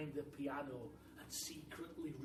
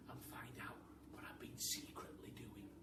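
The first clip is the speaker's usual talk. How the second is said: whisper; 4 semitones lower, 6 dB quieter than usual. Acted. Secretly reading, hiding from teachers.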